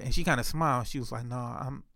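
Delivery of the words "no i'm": soft, lower imitation of her reply; hushed, low-pitched hedging; subtle tone. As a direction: understate it, quietly holding back. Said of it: "using, like, the tone of her voice".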